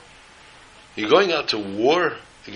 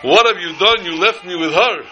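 higher, louder mock-tragic wail to the dead; hammed-up lament; 7 semitones higher, 5 dB louder than usual. Camper acting brother mourns his dead brother, mock-tragic lament. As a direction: play at grieving over a brother, pitch raised. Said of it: "this is supposed to be an emotional scene".

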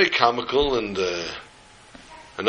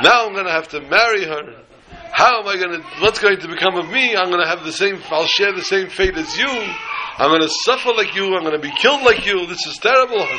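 high-pitched mock-tragic wailing, comic despair; hammed-up lament; 7 semitones higher than usual. Brother wails to the dead man about his fate, hammed-up lament. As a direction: bemoan your fate with stagey anguish. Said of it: "this is supposed to be an emotional scene".

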